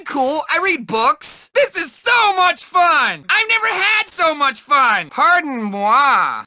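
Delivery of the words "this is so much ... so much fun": high, giddy glee; delighted. Acted. Nerd on a ride, giddy and high. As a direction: bubble over with joy.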